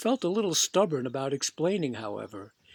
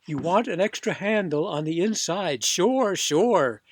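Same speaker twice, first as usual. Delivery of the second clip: louder, mocking sure, sure; louder, sarcastic serviceman; sarcastic humoring; 2 semitones higher, 5 dB louder than usual. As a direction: humor the odd customer.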